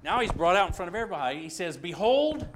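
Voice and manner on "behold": proclaiming. Acted. Pilate presents Jesus to crowd.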